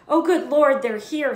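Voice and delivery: high, loud flustered gasp; aunt flustered by guests arriving; frantic. A narrator's reading. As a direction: panic as the doorbell rings. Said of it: "said Aunt Petunia frantically".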